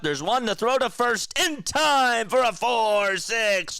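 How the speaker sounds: building excited play-by-play; excited play call. Acted. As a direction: double play call rising high and loud, stretched out.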